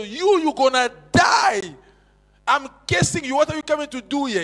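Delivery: loud, high, furious cursing yell; drunk man's angry shout. Retold or imitated. Drunk man shouting curses at the street preachers, furious. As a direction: roar the curse like a drunk threatening you.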